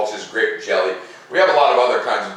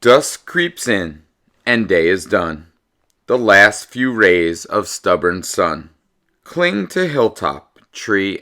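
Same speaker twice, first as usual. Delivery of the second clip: loud, slow storybook reading; picture-book read-aloud, slow and lively; reading to children; 5 dB louder, slower than usual. Acted. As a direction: read the rhymes out to the class with relish.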